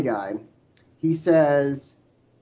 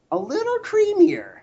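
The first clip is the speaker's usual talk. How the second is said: high squeaky voice. Real, not acted. Savoring peanut butter taste.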